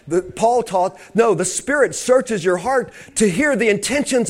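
high, impassioned preacher's delivery; fervent preaching. Real, not acted. Preacher teaching how the Spirit prays through believers, preaching fervently. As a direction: press each phrase home with rising fervor.

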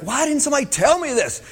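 high, exasperated cry of why; mock indignant complaint; frustrated outburst. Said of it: "because I was so frustrated".

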